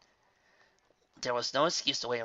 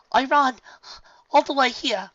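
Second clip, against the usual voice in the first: out of breath; 13 semitones higher, 11 dB louder than usual. A narrator's reading. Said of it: "gasping for breath".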